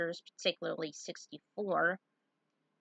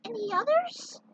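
high, far-off voiced figure; a high piping character voice; 4 dB louder than usual. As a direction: pitch the voice way up like a small character.

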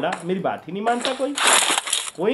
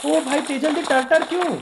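very high, loud yelps of disbelief; shocked outcry; 11 semitones higher, 4 dB louder, slower than usual. Real, not acted. Streamer seeing a player flip over, shocked outcry. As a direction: cry out in alarm and demand why.

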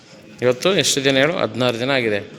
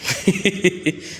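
light laugh; 7 semitones higher than usual. Real, not acted. Trainer laughs at client.

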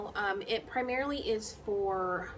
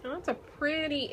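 usual speaking voice

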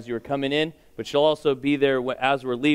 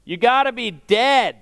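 put-on high, loud five-year-old's shout; blurted kid's holler, much higher; little boy shouting; 8 semitones higher, 5 dB louder than usual. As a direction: yell it out like a kid from the back. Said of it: "a five-year-old boy shouted out".

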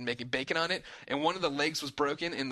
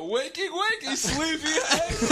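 high, slow sing-song teasing, laughing; teasing wake-up call; 13 semitones higher, 4 dB louder, slower than usual. Retold or imitated. Waking Dunham at four AM, teasing sing-song laughing. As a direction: drag it out mockingly. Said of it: "And I go".

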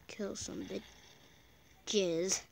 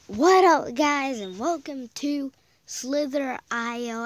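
YouTuber intro; 3 semitones higher, 8 dB louder, slower than usual. Acted. Another channel intro.

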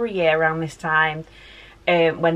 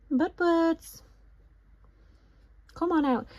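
high, softer coaxing call; high call to dog; 10 semitones higher, 4 dB quieter, slower than usual. Real, not acted. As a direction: coax the dog out sweetly. Calling dog from under bed.

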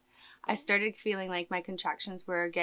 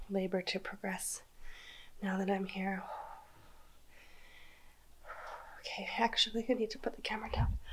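hushed whisper during labor; 8 dB quieter, faster than usual. Real, not acted. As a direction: whisper so the nap continues. Whispering while toddler naps.

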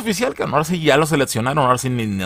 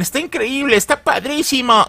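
much higher, gushing praise; mock fan gush; 13 semitones higher than usual. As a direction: imitate fans raving. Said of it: "Y muchos decían".

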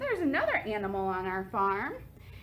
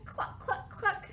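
chicken cluck; 9 semitones higher, 3 dB quieter, slower than usual. Shown as a demonstration. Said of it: "our chicken likes to say".

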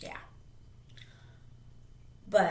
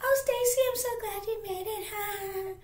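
mock her fake-sweet gushing greeting; exaggerated squeaky girly imitation; mean girl's voice; 13 semitones higher than usual. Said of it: "that's how she talked".

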